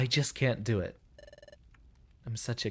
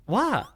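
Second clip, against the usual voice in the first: a high, loud startled yelp; 10 semitones higher, 4 dB louder than usual. Acted. Hero startled by the sentry's shout, yelping high. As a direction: jump out of your skin with a cry.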